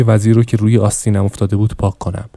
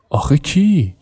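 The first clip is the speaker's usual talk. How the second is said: curious question; 5 semitones higher, slower than usual. Acted. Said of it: "مرلین کنجکاوانه پرسید".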